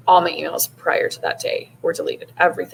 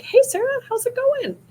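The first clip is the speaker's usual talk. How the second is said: much higher, louder bright greeting; upbeat boss answering the phone, sunny and high; cheerful friend; 4 dB louder than usual. Retold or imitated. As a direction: imitate a boss in a great mood. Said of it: "she's seriously always in the best moods".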